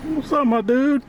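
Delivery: high squeaky voice for a pet; talking to a dog. Real, not acted. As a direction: greet the dog in a cute voice. Greeting a dog.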